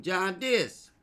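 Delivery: a mock New York drawl on John Dis; East Coast accent. Shown as a demonstration. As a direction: say it like someone from Brooklyn. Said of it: "that was that East Coast shit".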